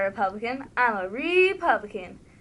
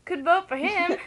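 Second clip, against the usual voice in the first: laughing; 5 semitones higher than usual. Real, not acted. Joking about the candidate.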